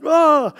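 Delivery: sharp high yelp of pain. Retold or imitated. Pastor recalling the jolt, sharp yelp. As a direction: cry out as the nerve gets hit. Sudden pain from hitting arm.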